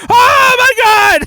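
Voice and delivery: drawn-out, very high, loud wail; imitated baby scream. Co-host mimics the baby losing his teddy bear, screaming. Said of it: "the way he screamed".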